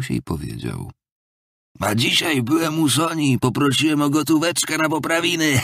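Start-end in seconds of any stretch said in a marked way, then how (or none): 1.8-5.6 high, brazen jeering that breaks into laughter; feigned mockery, forced insolence. A narrator's reading. Drunkard brags to Raskolnikow of begging money, with forced insolent mockery. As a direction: taunt with put-on cheek, pitched up, then cackle. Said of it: "Z jakimś udanym kpiarstwem, z wymuszoną bezczelnością".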